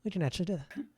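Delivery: a hint of a friendly waitress greeting customers; mild shop-girl politeness; polite waitress.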